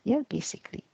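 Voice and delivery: ordinary talk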